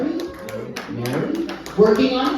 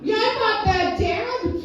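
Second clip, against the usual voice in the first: put-on teen reply; 13 semitones higher than usual.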